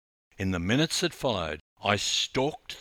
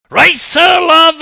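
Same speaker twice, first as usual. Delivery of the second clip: slow, much higher and louder scream with stress on love; 20 dB louder, slower than usual. Acted. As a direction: bellow it across the pool. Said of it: "I screamed even louder".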